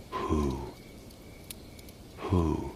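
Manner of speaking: soft, drawn-out owl hoot. A narrator's reading. Narrator imitates an owl calling in the dark, hooting softly. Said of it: "Who is that hooting out there".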